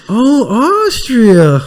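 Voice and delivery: hammed-up delighted gasp; mock delighted surprise. Retelling the limo scene, a mock-delighted Lloyd. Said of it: "She's like".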